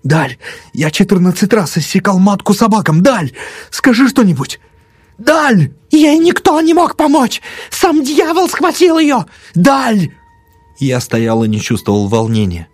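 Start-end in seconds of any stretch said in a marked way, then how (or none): 0.0-5.7 high, escalating desperate cries; frantic appeal. A narrator's reading. Panicked doctor begs his friend to speak, frantic. 5.9-9.2 very high, wailing outcry; hysterical screech. A narrator's reading. Old woman blames the devil, screeching. 9.5-10.1 high-pitched cry for a friend; desperate call. A narrator's reading. Panicked doctor calls his friend, desperate cry.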